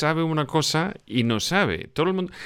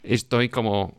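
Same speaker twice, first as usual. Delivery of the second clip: ordinary delivery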